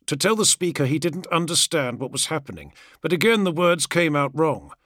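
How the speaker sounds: usual speaking voice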